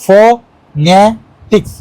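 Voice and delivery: "Phonetics" very slowly in separated syllables. Shown as a demonstration. Teacher shows students the syllable break, "phonetics" slowly syllable by syllable. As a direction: break "phonetics" into three parts, pausing after each. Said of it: "তাহলে কেমনি হলো?".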